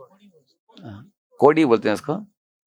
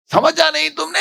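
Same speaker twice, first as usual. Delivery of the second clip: much higher, shouted question; husband yelling; 4 dB louder than usual. Retold or imitated. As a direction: mimic a husband shouting at his wife. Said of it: "ऐसे बोल रहा है". (पति का पत्नी पर चिल्लाना)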